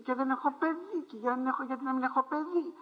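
high, pleading woman's voice to God; Hannah's lament. Retold or imitated. Hannah begs the Lord for a child, high and pleading. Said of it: "του μιλούσε του Κυρίου".

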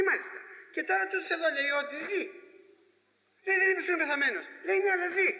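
high-pitched baffled back-and-forth; acted incredulous exchange. Acted argument over dead man living.

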